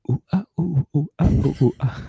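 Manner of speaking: whispered ooh and ah; breathy moaning. Retold or imitated. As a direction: breathe the sounds like a secret. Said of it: "That's the secret".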